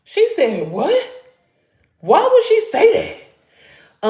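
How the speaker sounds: slow, high, disbelieving; shocked disbelief. Retold or imitated. To viewers, retelling her outraged disbelief. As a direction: she can't believe what she heard. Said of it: "I was like".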